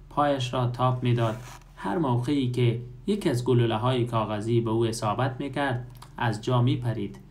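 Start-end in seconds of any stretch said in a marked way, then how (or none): none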